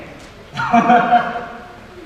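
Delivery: a short breathy laugh; amused laugh. Acted. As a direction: laugh it off, delighted by the insult. Amused by Minerva's barb.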